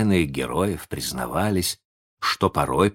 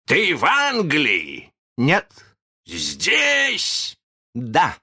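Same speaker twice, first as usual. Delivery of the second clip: диалог; 9 semitones higher, much slower than usual. A narrator's reading. Guessing game continues.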